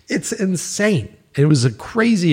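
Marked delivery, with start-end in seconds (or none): 0.1-1.2 laughing. Real, not acted. Laughing after own story.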